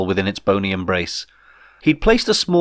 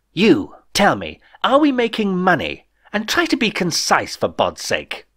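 impatient; 8 semitones higher than usual. A narrator's reading. Impatient dictator demands.

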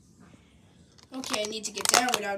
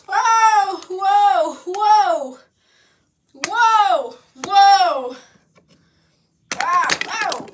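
loud whoas; 6 semitones higher, 7 dB louder, much slower than usual. Acted. Flying, thrown about.

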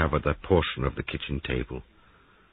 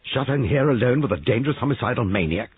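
higher, louder indignant rebuke; angry scolding; 7 semitones higher, 6 dB louder than usual. Acted. Giles scolding Molly over the maniac, angry. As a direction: scold her in disbelief.

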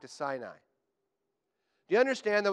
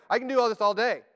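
higher, louder heroic boast; Captain America; 7 semitones higher, 6 dB louder than usual. Retold or imitated. Preacher voicing Peter as Captain America, mock heroic. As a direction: toss it off like an unbeatable superhero. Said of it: "he's like Captain America".

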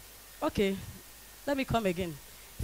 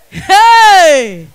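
a sharp, much louder call of hey; loud shout; 5 semitones higher, 11 dB louder than usual. Acted. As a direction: shout it back across the room. Shouting back at a caller.

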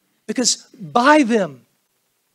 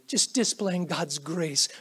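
usual speaking voice

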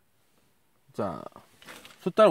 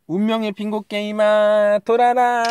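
dramatic incantation; 6 semitones higher than usual. Pretend. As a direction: command the machine like a wizard. Commanding bingo machine to spin.

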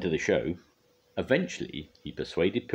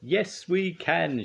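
high, drawn-out group cheer; shouting; 13 semitones higher, 3 dB louder, much slower than usual. A narrator's reading. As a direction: shout back as a whole crew. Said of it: "shouted the others".

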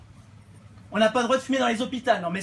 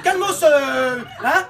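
put-on warning the kid; 4 semitones higher, 5 dB louder, slower than usual. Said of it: "Je dis".

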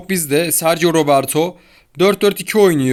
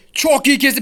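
much higher, thrilled call; sudden burst of excitement; excited commentary; 9 semitones higher than usual. Real, not acted. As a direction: shout it like a great play just happened. Commentating own match excitedly.

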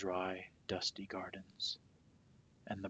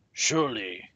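loud, proud boast; a booming boast; boastful; 13 dB louder than usual. A narrator's reading. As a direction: puff up like a vain king. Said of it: "he boasted".

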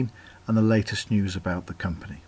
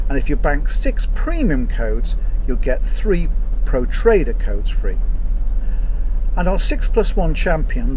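louder, higher, driving sales-pitch delivery; energetic promo read; 3 semitones higher, 8 dB louder than usual. Acted. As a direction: pump up the promotion like an ad. Loud energetic promo section.

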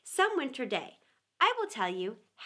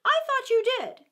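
high mouse voice; 13 semitones higher, 6 dB louder than usual. Acted.

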